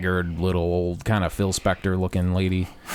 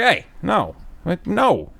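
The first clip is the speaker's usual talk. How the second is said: higher-pitched, emphatic telling-off; firm scolding; 9 semitones higher, much slower than usual. Retold or imitated. Storyteller to the lady who slapped his arm, firm scolding. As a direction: re-enact a sharp refusal, pitch jumping up.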